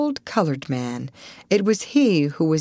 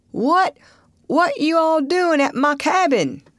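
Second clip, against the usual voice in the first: high, startled holler; calling out; 11 semitones higher than usual. A narrator's reading. Startled old boatman finding strangers at his cabin, calling out. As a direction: holler it across the water in Southern dialect. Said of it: "and called out".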